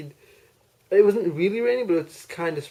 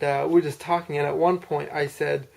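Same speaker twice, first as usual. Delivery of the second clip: ordinary delivery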